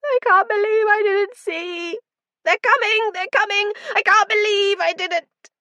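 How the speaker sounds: high, loud, panicked wailing; weeping shriek. A narrator's reading. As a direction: shriek it out through sobs, terrified. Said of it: "He was weeping".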